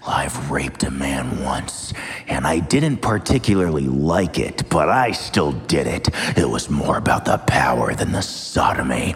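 very low, whispered creep voice; deep sinister whisper; creepy whisper. Pretend. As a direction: breathe it like a menacing creep. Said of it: "I can sound like the most creepy motherfucker".

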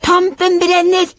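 very high excited shriek; high-pitched gleeful yell. A narrator's reading. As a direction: cry it out at the top of the register. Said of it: "skrek de ... så høyt de bare kunne".

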